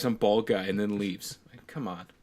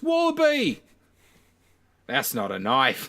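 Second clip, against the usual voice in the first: put-on Australian accent; 3 semitones higher, 3 dB louder, slower than usual. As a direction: yelp it in a hammed-up Australian accent. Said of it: "for us to do an Australian accent".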